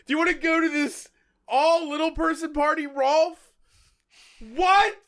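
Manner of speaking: high-pitched, incredulous mock outrage; mock disbelief. Mocking an invitation to an all little person party, incredulous. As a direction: play up baffled indignation, pitched well up.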